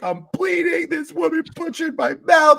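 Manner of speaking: very high-pitched imitated wailing; exaggerated tearful squeal down the phone; mock hysterical crying. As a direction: wail it like a hammed-up sobbing wife. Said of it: "and hysterically crying".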